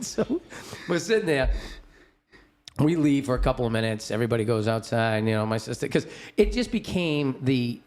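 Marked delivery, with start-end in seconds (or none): none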